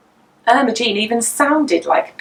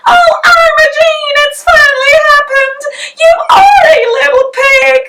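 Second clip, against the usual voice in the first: much higher, louder, half-sung cry of dismay; distraught wail; 8 dB louder than usual. A narrator's reading. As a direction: wail at the baby who became a pig. Mother finds piglet in pram.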